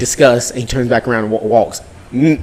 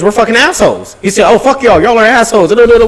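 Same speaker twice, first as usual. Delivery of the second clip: higher, louder mock insult; hammed-up thief yelling insults; imitating thief's retort; 8 semitones higher, 7 dB louder than usual. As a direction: voice the thief sneering back.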